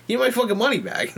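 high, indignant retold demand; mock outrage, voice jumping up; retold argument. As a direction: act out demanding a refund. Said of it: "as I'm arguing with this lady".

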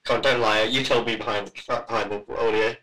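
ordinary delivery

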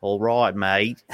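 teasing, hammed-up Australian vowels; mock Australian accent. Co-host teasing before his list, mock Australian accent. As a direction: put on a jokey Australian accent.

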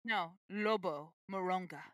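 put-on slow, Latin-accented name; lightly put-on Latin accent. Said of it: "saying it with a Latin accent".

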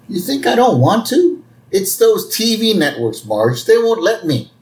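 high-pitched mock whine; defensive protest. Homer protests to Marge.

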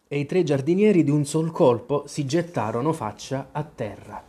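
ordinary delivery, nothing marked

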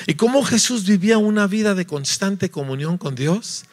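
ordinary talk

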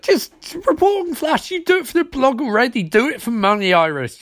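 high, pleading exasperation; exasperated rant. Acted. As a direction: argue it in a high, incredulous voice. Exasperated rant addressed to character.

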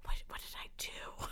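whispered, bewildered self-questioning; hurt whisper. Retold or imitated. Replaying the donut shop run-in at home, hurt whisper. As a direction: whisper it as if wounded.

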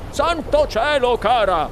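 high start, flustered man's voice; exclaiming husband. A narrator's reading. Husband exclaiming to his wife, flustered. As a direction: blurt it in dismay. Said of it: "esclamò il signor Gloop".

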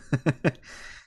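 short unvoiced laugh; breathy laugh. Real, not acted. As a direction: laugh in disbelief. Laughs at the written text.

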